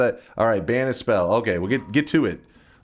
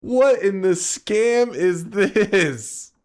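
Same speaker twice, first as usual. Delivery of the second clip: slow outrage; 8 semitones higher, 3 dB louder, much slower than usual. Real, not acted. Outraged at ref decision.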